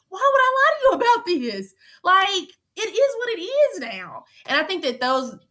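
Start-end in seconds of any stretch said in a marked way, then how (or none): 0.1-4.2 pushing back hard on her accuser; heated plea. Retold or imitated. Retelling heated jury confrontation.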